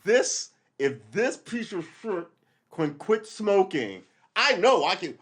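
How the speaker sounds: slow and defiant, ending in a loud vow; defiant resolve. Retold or imitated. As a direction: talk yourself into it with stubborn pride. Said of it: "So I was like".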